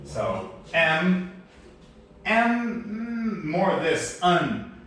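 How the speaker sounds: M drawn out, high, closing the lips; un said like a nasal hum; demonstrating sounds. Shown as a demonstration. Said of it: "The Taiwanese usually say un".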